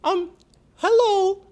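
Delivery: very high, loud squeaky puppet. Pretend. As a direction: call out like a silly puppet. Puppet greets Kendra.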